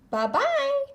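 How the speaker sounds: high squawky farewell; quick duck-voiced bye; Donald Duck goodbye. A narrator's reading. Donald bids Goofy farewell in a high duck voice.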